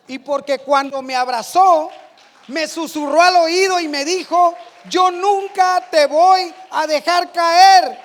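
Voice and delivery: loud, slow, fervent sermon delivery; full-force preaching. Acted. Predicador proclama a la congregación la promesa de Cristo, a voz en cuello. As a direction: preach it to the back row.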